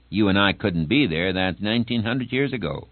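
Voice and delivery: usual speaking voice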